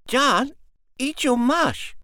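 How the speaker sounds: a small sister bossing her brother; little girl. Acted. Pearl nags brother again.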